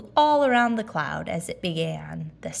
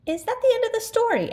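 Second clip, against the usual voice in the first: put-on higher little boy asking; child voice; faster than usual. Christopher Robin asking his father, high child voice. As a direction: ask sleepily like a small child.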